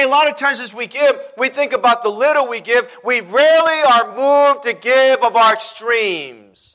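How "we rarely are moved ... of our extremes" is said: drawn out for the congregation; slow, weighty preaching. Acted. Preacher slowing to stress giving. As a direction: let each word land slowly. Preacher drives the point home.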